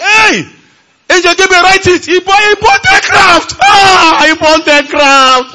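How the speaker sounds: high, loud mock cry to an angel; a preacher mocking angels who broadcast purchases; mock heavenly announcement. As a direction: shout it up like a herald in heaven, in jest.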